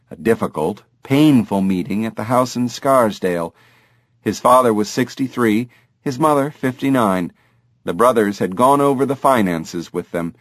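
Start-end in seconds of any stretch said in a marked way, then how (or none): none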